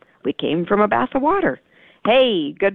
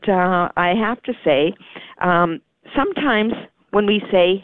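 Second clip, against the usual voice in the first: ordinary talk, nothing marked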